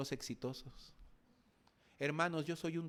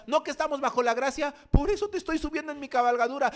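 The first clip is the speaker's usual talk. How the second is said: Samaritan explaining; 12 semitones higher, 10 dB louder, faster than usual. Acted.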